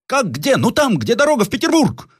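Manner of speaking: very high, exasperated reply; high-pitched exasperation; impatient general. Acted. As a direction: explain impatiently, voice way up. Impatient general explains to slow workman.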